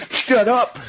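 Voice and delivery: high squeal of laughing disbelief; laughing shut up. Real, not acted.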